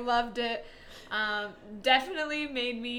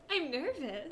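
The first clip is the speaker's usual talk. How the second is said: higher, soft, giggly mock nerves; acting cute and nervous; 4 semitones higher, 6 dB quieter than usual. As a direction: play cute and nervous through a little laugh. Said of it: "I'd try to be cute".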